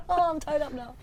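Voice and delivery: high, playful cutscene voice; mock captive. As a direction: act the tied-up victim as a joke. Acts out the next cutscene.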